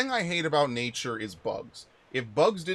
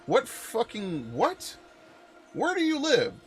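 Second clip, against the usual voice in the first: high, disbelieving what?; incredulous; 8 semitones higher than usual. Real, not acted. Streamer reacting to chat about acid-spitting grasshoppers, incredulous and high.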